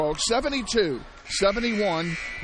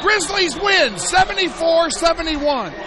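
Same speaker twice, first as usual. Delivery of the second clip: much higher and louder triumphant yell; victory shout; 9 semitones higher, 7 dB louder than usual. Real, not acted. Radio announcer calling the home team's win to fans, jubilant shout. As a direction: erupt as the shot falls short. Buzzer, home team wins.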